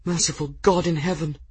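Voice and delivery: high, hushed dismay; breathed in horror. A narrator's reading. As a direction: breathe it out, appalled. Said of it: "Gwendolyn breathed".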